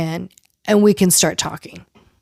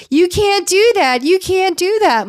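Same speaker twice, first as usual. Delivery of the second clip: higher, drawn-out retold objection; mock protest; 8 semitones higher than usual. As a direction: imitate her indignant protest, pitched up. Retelling a reunion argument.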